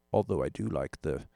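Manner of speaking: a hint of a vague toff accent, fading away; put-on posh accent. Poking fun at rich gear snobs, vague put-on accent. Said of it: "I don't know what accent that is".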